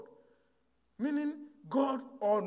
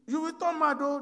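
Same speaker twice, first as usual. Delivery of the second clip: higher and louder, warning him off; raised warning; 6 semitones higher, 5 dB louder than usual. Retold or imitated. Brother warns Shola against opening the coffin, raised warning. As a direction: cry out a warning that he'll go mad.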